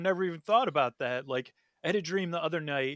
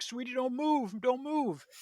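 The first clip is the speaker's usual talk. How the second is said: much higher, urgent plea to the dog; 9 semitones higher, slower than usual. Retold or imitated. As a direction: beg the dog to freeze. Dog's ear hooked on fence.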